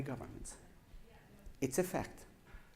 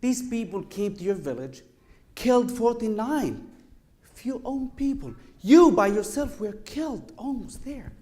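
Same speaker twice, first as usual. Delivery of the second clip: higher, louder outraged protest; angry son; 7 semitones higher, 7 dB louder than usual. Retold or imitated. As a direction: throw the injustice back at him. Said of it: "So his kid was so angry".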